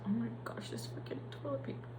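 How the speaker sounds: whispered annoyed aside under the breath. Real, not acted. Crying nurse whispers annoyance at the toilet paper.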